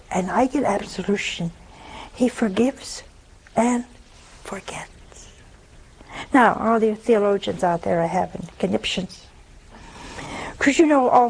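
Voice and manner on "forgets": whispered word. Real, not acted. Trailing hushed key word.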